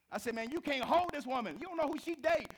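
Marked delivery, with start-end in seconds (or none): 0.3-1.6 high, loud protest; loud, high warning to the store owner; retold confrontation. 1.6-2.5 high, loud urgent warning; loud, high warning to the store owner. Retold or imitated.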